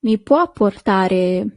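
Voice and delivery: "Mi può portare?" repeated slowly, rising on the end. Shown as a demonstration. Teacher repeating "Mi può portare?" slowly for students to repeat. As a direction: echo "Mi può portare?" gently so they can copy. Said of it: "Yes, that's".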